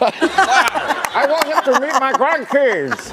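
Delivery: Nelson Mandela. Retold or imitated. Mandela wanting Chris to meet grandkids, slow South African accent. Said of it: "he was like".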